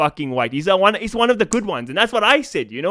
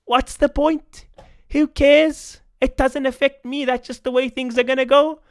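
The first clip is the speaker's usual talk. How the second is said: mocking Darren; 8 semitones higher than usual. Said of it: "So Darren's like".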